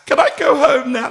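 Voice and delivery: high, loud plaintive begging; a mock desperate plea to go home; pleading plea. Pleading to leave, retold.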